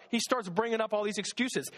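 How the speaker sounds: usual speaking voice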